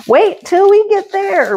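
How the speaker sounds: high, teasing sing-song; high sing-song teasing promise; 1 sing-song tease. Pretend. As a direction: tease the viewers in a high playful lilt. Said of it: "repeats it plainly after".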